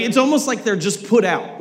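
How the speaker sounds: ordinary delivery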